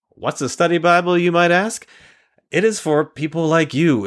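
bright upbeat ad-read pitch; sales pitch. Acted. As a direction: pitch it like a commercial. Pitching the giveaway.

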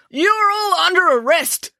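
high, slow bellow. Acted. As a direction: bellow the arrest at the kids. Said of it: "bellowed Constable Pike".

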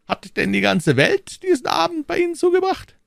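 high, cool and unhurried cardinal; phlegmatic cardinal. A narrator's reading. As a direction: say it with utter composure. Cardinal voiced.

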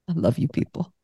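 soft, moved thank-you; tender gratitude. Retold or imitated. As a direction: say it softly, deeply touched. Touched reply to gluten-free dish.

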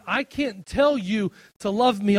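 loud and slow, leaning on "tell"; preaching at full force. Acted. Preacher proclaiming God's voice to the congregation, loud and slow. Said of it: "preacher's peak".

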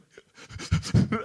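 loud, breath-only imitated sobs; heaving fake bawling; mock sobbing. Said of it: "He just cries his lamps out".

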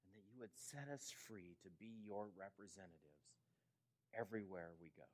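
soft, reverent prayer voice; closing prayer. Acted. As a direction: pray gently over the congregation. Pastor leads closing prayer.

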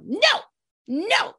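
put-on high, defiant toddler "No, no"; toddler's no. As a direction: squeak out a stubborn little no. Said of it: "he'd go".

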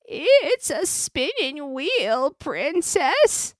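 put-on high, slow crooning old crone; old woman voice. A narrator's reading. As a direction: draw it out sweetly like a disguised witch. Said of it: "answered the old woman".

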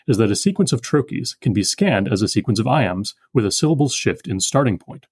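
ordinary talk, nothing marked

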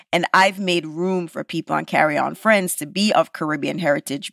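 normal talking voice

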